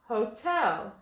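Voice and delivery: "Hotel" as ho-TEL, accent on the end; "Hotel" with its second syllable stressed. Shown as a demonstration. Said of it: "With the accent on the end sounds better".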